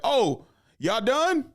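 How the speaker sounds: lazy uncle feigning surprise at the finished work; mock fake-helpful uncle. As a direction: play the uncle pretending he meant to help. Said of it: "They'd be like".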